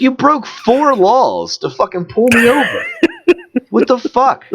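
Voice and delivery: high-pitched, incredulous protest; retold outraged accusation. Driver acting out accusing the cop of breaking laws, outraged.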